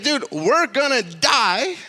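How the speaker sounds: high, slow incredulous protest; mock alarm. Disciples answering Jesus in the storm, mock alarmed protest. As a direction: stretch it out in acted disbelief and alarm.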